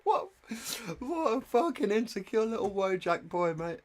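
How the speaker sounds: mock him while still giggling; laughing mockery. Real, not acted. Streamer to chat, mocking the boyfriend while laughing.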